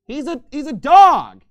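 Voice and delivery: mock stern parent insisting; firm loud reply. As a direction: insist loudly to the child. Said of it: "It's like".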